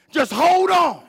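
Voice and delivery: high, loud urging; shouted encouragement. Retold or imitated. The Lord answering Walter in hard times, shouted urging. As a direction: proclaim it forcefully as God's answer. God's retold answer, repeated louder.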